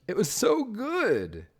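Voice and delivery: slow, high, drawn-out praise; gushing. Real, not acted. Praising Mineral's park, slow and gushing.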